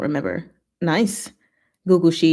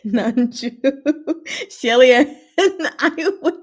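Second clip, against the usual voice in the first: laughing through the words, higher and louder; 7 semitones higher, 4 dB louder than usual. Real, not acted. Streamer laughing to her chat about fighters, laughing. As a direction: let a giggle break the line.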